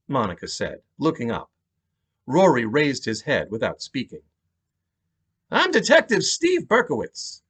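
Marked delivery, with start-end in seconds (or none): 5.5-7.4 high-pitched New York detective; thick New York cop accent. Acted. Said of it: "He had a thick New York accent".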